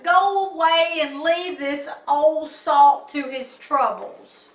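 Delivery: put-on loud grumpy old salt; grumbling old sea captain. Captain sending visitor away, loud grumbling. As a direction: bellow like a fed-up sailor.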